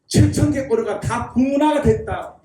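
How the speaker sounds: ordinary delivery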